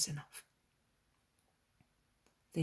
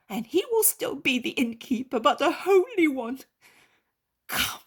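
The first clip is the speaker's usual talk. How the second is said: higher, louder, elated thanks; joyful innkeeper; 10 semitones higher, 12 dB louder than usual. A narrator's reading. Healed girl's father invites Jesus home, overjoyed. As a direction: overflow with grateful joy.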